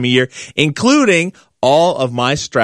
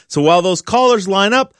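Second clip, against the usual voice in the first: ordinary talk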